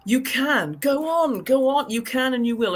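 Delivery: ordinary talk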